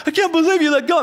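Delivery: high, whiny accusation; a hurt kid blaming the parent; mad child. Acted. Crashed child accusing the parent, high and upset. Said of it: "they're, like, mad".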